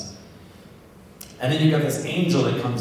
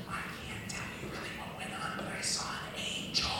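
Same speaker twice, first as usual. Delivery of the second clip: voiceless whisper, much softer; mock secret whisper; 14 dB quieter than usual. Priest hinting at the angel he saw, hushed whisper. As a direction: hush it like a forbidden secret. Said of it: "If you're gonna whisper".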